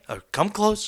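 secretive hush before the outburst. Pretend. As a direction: beckon them in almost whispering. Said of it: "let me let you in on a little secret".

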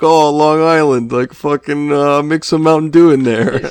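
put-on loud, high Long Island accent; brash Long Island voice, pushed up and loud. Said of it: "two sips and you have the accent".

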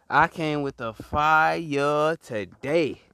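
drawn out slowly; slow proud declaration. Real, not acted. Preacher boasting to the crowd, slow and proud. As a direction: savour every word. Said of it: "I'm preach these minutes".

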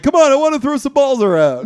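high, eager pleading; pleading robot voice. Retold or imitated. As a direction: beg to play catch. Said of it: "he's like".

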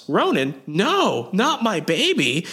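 a mother panicking over her baby, acted high and slow; mock panicked cry. Host retelling the woman panicking for her baby, mock panicked. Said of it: "the woman is freaking out".

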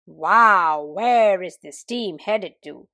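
loud wow, then slow drawn question; lightly voiced rooster. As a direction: crow it out like a proud rooster. Rooster voice, timbre shift.

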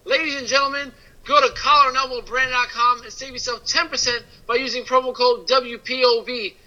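sponsor read. Acted. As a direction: read the promo like an ad spot.

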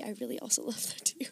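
giggly confessional whisper. Real, not acted. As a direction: whisper it like a guilty secret. Confessing a guilty-pleasure trope.

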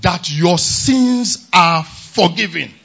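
loud, ringing declarations of blessing; full-force preaching. Acted. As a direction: hammer each promise home to the church. Preacher builds to shouted climax.

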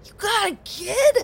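surprised. Acted. Surprised reaction in character.

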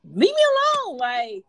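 high squeaky whine; high mock voice. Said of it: "that means".

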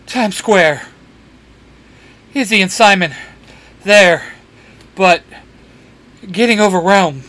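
breathless, gasping between words; out of breath, pausing to gasp; gasping between phrases. Acted. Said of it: "between gasps of air".

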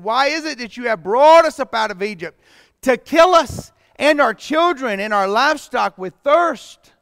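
loud, high grumbling complaint; the thirsty people accuse Moses, loud and high; grumbling crowd. Acted.

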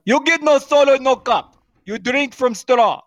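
high, loud broken-English server; mock foreign accent. Server role scolds customer over drink.

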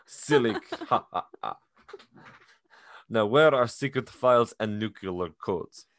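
slow, hammed-up Russian accent; thick mock-Russian accent; full Russian accent. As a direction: imitate a Russian spy faking friendliness. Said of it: "he has the full Russian accent".

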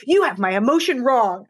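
playful imitation of a child's complaint; imitated screaming toddler. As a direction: lightly act out an indignant small child. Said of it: "this tiny child screaming at me".

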